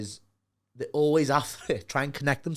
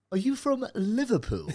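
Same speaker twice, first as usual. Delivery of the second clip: higher, drawn-out mock Southerner; Southerner's question; 7 semitones higher, 4 dB quieter, slower than usual. Southerner meeting a Liverpudlian, high slow mock question. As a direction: ask slowly, pitched up, as an impressed stranger. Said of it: "would literally be like".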